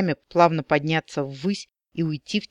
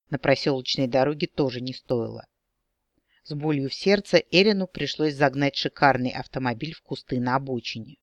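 usual speaking voice

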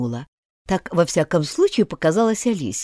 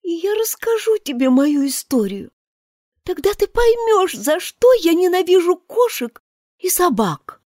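high, disgusted mouse voice; proud offended mouse sneering at cats; indignant mouse; 10 semitones higher than usual. Acted. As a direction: spit out the insults with contempt.

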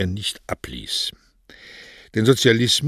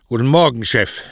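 louder, higher "Guten Morgen"; an obedient employee's louder greeting; dutiful repeated greeting; 3 semitones higher, 5 dB louder than usual. A narrator's reading. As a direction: greet the boss up and out, as ordered. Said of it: "forderte ihn dann auf, seinen Gruß zu wiederholen".

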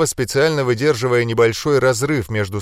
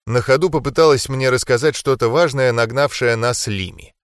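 usual speaking voice

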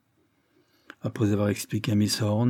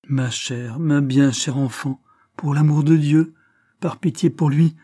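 urgent pleading, rising louder and higher; plea; 5 semitones higher, 6 dB louder than usual. Acted. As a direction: beg her to stop tormenting herself. Narrator pleads with dying girl. (supplication pressante, de plus en plus forte)

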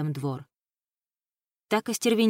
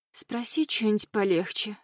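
mildly groaned, higher-voiced reply; a touch of a hungover moan; 3 semitones higher than usual. A narrator's reading. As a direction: lightly moan it out. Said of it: "простонала подруга".

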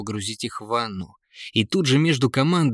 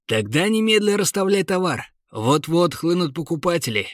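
jolly merchant; 6 semitones higher than usual. Acted. Jolly merchant urges newcomer.

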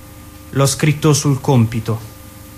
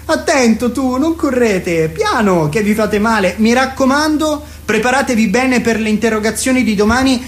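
agitated teacher; 7 semitones higher than usual. Acted. Teacher yelling at rowdy pupils.

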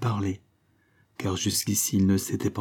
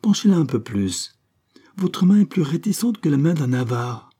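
higher, livelier teasing demand; insistent cry; 6 semitones higher, 4 dB louder than usual. A narrator's reading. Girl chiding Graham for his stingy pouring, insistent cry. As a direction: press him playfully, voice lifted. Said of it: "s'écria-t-elle".

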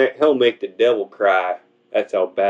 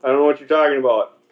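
higher, hurried, lightly voiced God; mock baffled God; 4 semitones higher, faster than usual. God answering the devil, baffled and hurried. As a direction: rush it out, baffled. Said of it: "God's like".

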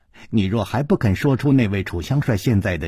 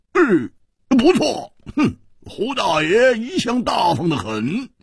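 higher, slow blustering boast; loud bravado; 9 semitones higher than usual. Acted. Hu Tiehua loudly faking generosity. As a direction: puff himself up aloud. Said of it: "嘴里却大声地说".

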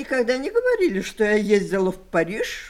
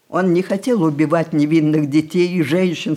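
ordinary talk

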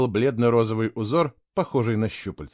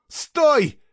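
high, louder shout of horror; horrified cry; 5 dB louder than usual. A narrator's reading. Girl stopping two boys at the portal, horrified cry. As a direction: cry out sharply in alarm. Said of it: "в ужасе прокричала она".

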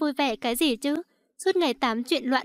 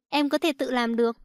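usual speaking voice

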